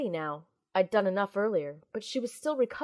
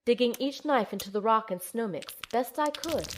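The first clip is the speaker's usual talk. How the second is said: ordinary delivery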